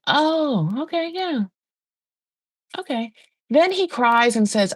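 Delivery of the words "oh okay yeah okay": high, softened "sure, okay" voice; mock-polite, high-pitched reaction; doubtful acknowledgement. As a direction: humour them while not buying it. Acted sceptical audience reaction.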